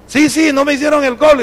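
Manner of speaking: high-pitched quick reassurance; eager boy, imitated. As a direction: act out a child suddenly cheered up. Boy brightens, answering his father. (niño animado, imitado)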